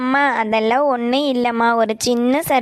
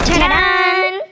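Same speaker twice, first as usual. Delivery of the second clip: excited reveal; 6 semitones higher, 4 dB louder, much slower than usual. Acted. Surprise reveal to parents.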